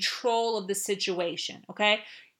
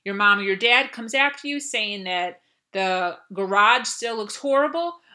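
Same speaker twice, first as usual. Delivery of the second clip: normal talking voice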